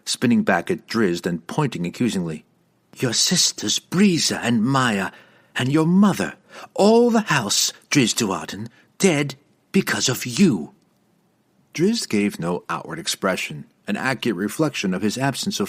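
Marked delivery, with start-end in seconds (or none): 3.0-10.7 accusing. Acted. Verna accusing Drizzt, continued.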